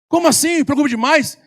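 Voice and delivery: high, loud incredulous question; indignant disbelief. Retold or imitated. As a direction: throw the question back in disbelief. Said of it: "Aí ele". (pergunta incrédula, alta e aguda)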